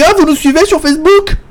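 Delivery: bright high imitation of the owner; high mock patronne voice. Imitating the café owner asking about Facebook, high-pitched. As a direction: pitch it way up, playful. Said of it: "Elle me dit".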